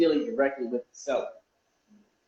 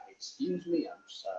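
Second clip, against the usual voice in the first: ordinary delivery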